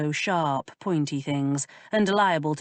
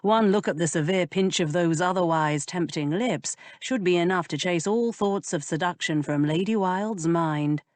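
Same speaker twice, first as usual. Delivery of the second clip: ordinary delivery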